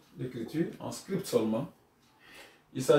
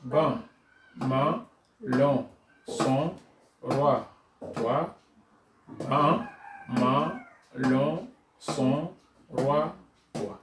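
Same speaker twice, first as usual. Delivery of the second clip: syllables read slowly, one by one; syllable drill; much slower than usual. Shown as a demonstration. Teacher reading syllables aloud with the class. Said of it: "Encore une fois".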